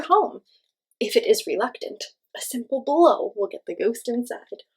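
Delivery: lively, expressive storybook voice; reading to children. Acted. Teacher reading a ghost guide to children, lively. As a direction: read it warmly and playfully to kids. Reads picture book aloud.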